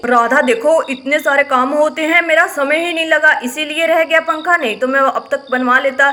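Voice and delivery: low husband voice, a bit put-upon; brother Shyam's voice. Acted. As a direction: play the tired older brother grumbling over a broken fan. Husband excusing himself to wife.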